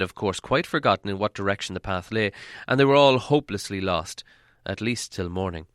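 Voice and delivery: ordinary delivery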